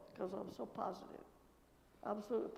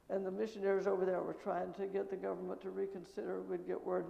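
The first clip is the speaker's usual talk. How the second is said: ordinary talk, nothing marked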